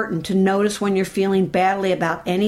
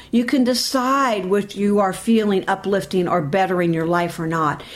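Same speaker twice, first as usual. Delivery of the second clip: ordinary talk, nothing marked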